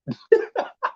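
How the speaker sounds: sudden high-pitched burst of laughter; a real squeaky laugh. Real, not acted. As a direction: crack up at the thought. Laughs at smiling deaths.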